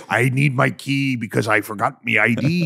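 lightly voiced pirate accent; a casual pirate asking for his ID. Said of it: "saying normal things in a pirate voice".

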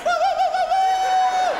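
high, loud imitation Southern "woo" howls; wolf-like whooping. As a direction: whoop like wolves calling across the wilderness. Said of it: "They're like wolves in the no-, wilderness".

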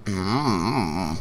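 wavering hum with the voice breaking; voice cracking. Real, not acted. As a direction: let the hum wobble and crack. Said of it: "My voice just cracked there".

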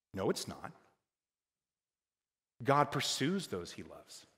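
ordinary talk, nothing marked

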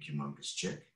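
ordinary delivery

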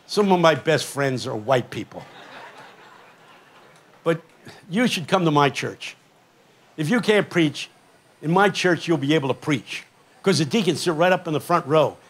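ordinary delivery

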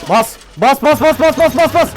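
loud, high repeated chant; frantic urging. Real, not acted. Player urging his character to flee the monster, frantic loud chant. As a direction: hammer out the command in a panic. Urging himself to run in game.

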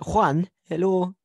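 slow, laughing mock Mexican accent. Teasing cohost after being told stop, mock Mexican accent. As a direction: ham up a Mexican greeting while laughing. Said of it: "Please stop".

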